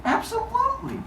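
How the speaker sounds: high chirpy yes. Retold or imitated. Gaia answers.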